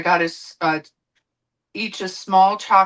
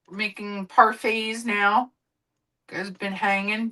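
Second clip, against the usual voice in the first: usual speaking voice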